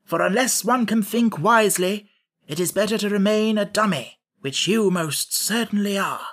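severe. A narrator's reading. Scarecrow lecturing Jack on thinking, severely. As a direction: scold a fool from on high. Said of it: "returned the Scarecrow severely".

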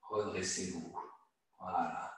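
low, very soft guiding voice; quiet, settled coaching; gentle instruction. Real, not acted. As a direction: ease them back up, hushed and low. Gentle cue after releasing muscles.

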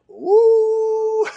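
a high joyful whoop; whooping praise; high ecstatic woo. Real, not acted. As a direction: let out a high cry of joy. Ecstatic exclamation.

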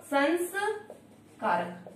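संस्कारक stretched very slowly, syllable by syllable; teacher carefully sounding out संस्कारक; slow demo. Shown as a demonstration. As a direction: draw out संस्कारक for the class. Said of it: "समधन कारक क्या हो गया".

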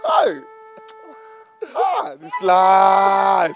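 slow, drawn-out crying shout; crying lament. Acted. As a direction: stretch it out in a loud wail. Friend grieves with him.

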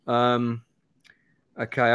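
ordinary talk

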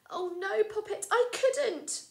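high, loud frightened protest; fearful cry. Acted. Mother elephant refusing her child in fright. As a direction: shrink back in fear.

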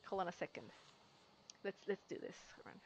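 hushed, very soft; whispering while hiding. Real, not acted. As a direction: keep it down so nobody hears. Hiding from enemy in game.

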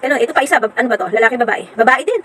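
ordinary talk, nothing marked

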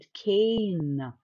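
cane said very slowly with a long A; long vowel demo. Shown as a demonstration. Teacher models the magic E word, slow and stretched. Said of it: "خليت الساوند بتاع ال A".

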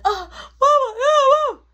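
very high, loud howl of pain; imitated wailing. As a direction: cry out for mama, hammed-up. Brother howling while being hit.

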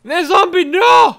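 high panicked shriek at zombie; panicked scream. Real, not acted.

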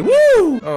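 piskliwe, głośne „woo”; wrzaśnij wysokie, przeciągłe „uu”; okrzyk „woo”. Real, not acted. Okrzyk podczas gry. (wysoki okrzyk gracza)

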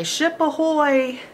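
lightly voiced sailor hailing a ship; sailor call. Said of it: "It's what sailors say".

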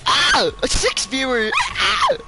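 high squealing excitement; panicking gamer. Real, not acted. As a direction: shriek with joy. Screaming over viewer count.

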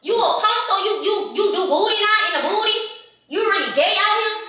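high-pitched heated accusation; hammed-up outrage for the prank; fake furious. Staged prank accusation, fast.

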